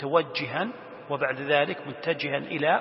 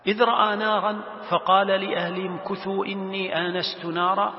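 measured, slightly raised recitation; Quran recitation; 4 semitones higher, 3 dB louder than usual. Acted. Teacher quoting God's words to students, reciting. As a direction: recite the verse in sustained tones. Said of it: "يقول الله سبحانه وتعالى".

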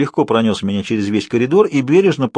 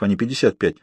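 ordinary delivery

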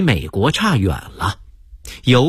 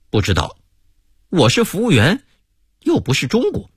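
ordinary delivery

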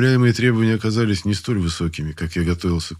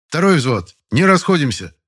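loud, high order to the platoon; command shout; 8 semitones higher, 5 dB louder than usual. Acted. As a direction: hold the platoon in place. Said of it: "скомандовал командир взвода". (громкая команда взводного)

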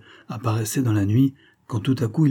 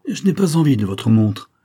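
louder, higher contempt; scornful cry; 4 semitones higher, 5 dB louder than usual. A narrator's reading. Stranger spurns the major's watch, crying out scornfully. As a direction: throw it out with scorn. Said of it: "s'écria-t-il d'un ton de mépris".

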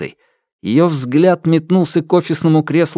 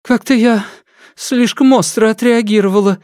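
murmuring secretary; 10 semitones higher than usual. A narrator's reading. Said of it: "пробормотала Диана".

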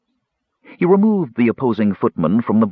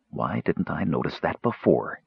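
softer, puzzled question to himself; asking himself; 5 semitones lower, 7 dB quieter than usual. Acted. Raistlin puzzling over the necklace, quietly to himself. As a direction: murmur it to yourself, half wondering. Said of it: "he asked himself".

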